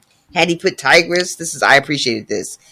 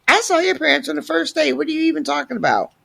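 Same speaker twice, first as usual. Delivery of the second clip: high, fast, baffled voice; mock incredulous boy; 12 semitones higher, 4 dB quieter, much faster than usual. Boy brushing off the sick girl, high and baffled. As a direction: squeak it out in disbelief.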